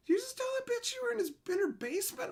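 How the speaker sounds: very high, rushed squeak of disbelief; mock aghast disbelief. Buddy reacting to basement prank, aghast. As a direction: imitate a scandalized coworker, high and quick.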